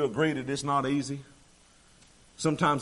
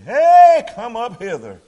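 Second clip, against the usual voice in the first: "Hey" cried very high and loud, then settling; God calling them home; God's booming call; 11 semitones higher, 17 dB louder, slower than usual. Acted. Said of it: "God himself gonna say".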